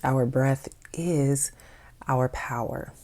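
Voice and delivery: unhurried meditation voice, long pauses between phrases; guided meditation. Acted. Host leading listeners to notice their breathing, guided meditation voice. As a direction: guide them calmly into the breath, leaving space after each phrase.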